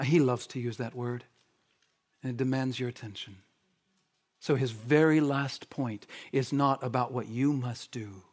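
normal talking voice

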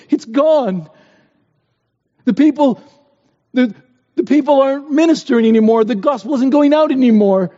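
a preacher voicing a mourning pastor's cry; impassioned lament. Boston's lament quoted with passion.

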